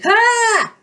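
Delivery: very high, loud held cry; battle yell. A narrator's reading. Hero runs straight at the monster crab, yelling. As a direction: yell it out as you charge.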